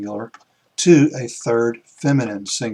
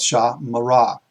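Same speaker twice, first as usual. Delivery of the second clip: "sha, mara." with a small stop after "sha"; slight pause after sha; 3 dB louder than usual. Shown as a demonstration. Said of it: "A slight pause".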